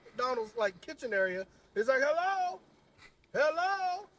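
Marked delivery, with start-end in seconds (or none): 2.0-4.1 raised, high calls for help, getting impatient; old man calling out. Retold or imitated.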